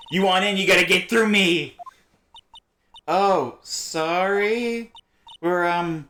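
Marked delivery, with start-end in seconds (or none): 0.1-1.7 gruff guard voice. Acted. Security guard voice.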